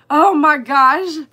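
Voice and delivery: usual speaking voice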